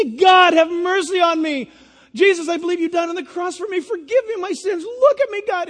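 high, loud pleading shout; pleading cry. Pretend. Sinner crying to God for mercy, high and loud. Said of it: "and you say".

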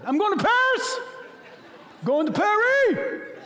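very high, loud squeal of joy; overjoyed traveler shouting; shrieking with laughing excitement; thrilled. Acted. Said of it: "why are you so excited".

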